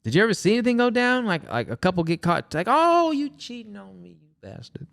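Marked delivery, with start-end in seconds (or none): 2.7-4.9 high, drawn-out accusation that drops away; mock outrage. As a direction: play a betrayed lover, half-hearted. Acts out a couple getting caught.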